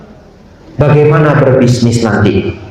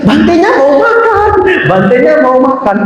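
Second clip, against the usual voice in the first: much higher, insistent nagging; urgent nagging; 11 semitones higher than usual. Retold or imitated. Mothers nagging the photographer once more: higher insistent urging. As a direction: nag again, voice climbing high.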